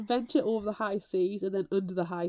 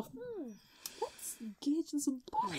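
mock musing; 8 semitones higher, 8 dB quieter than usual. Mock musing about piracy.

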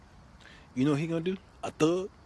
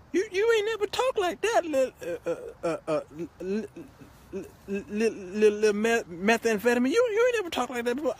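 high-pitched, stammering disbelief; astonished girlfriend; 7 semitones higher than usual. Acted. Girlfriend stunned by her thug's confession, mock astonishment.

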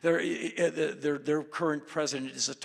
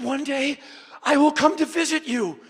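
lightly voiced Kim; 11 semitones higher, 9 dB louder than usual. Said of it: "he said".

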